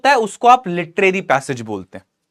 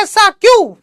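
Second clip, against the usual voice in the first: much higher, surprised female voice; Samantha's voice; 13 semitones higher, 6 dB louder, slower than usual. Acted. Teacher voicing Samantha, high and surprised. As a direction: ask it surprised, pitched up high. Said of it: "Samantha बोलती है".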